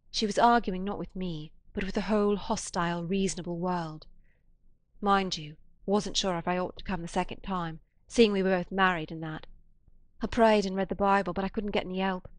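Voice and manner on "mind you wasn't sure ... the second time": plain reading voice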